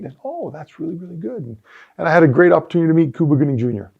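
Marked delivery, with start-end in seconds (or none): 0.2-1.4 quiet retold praise.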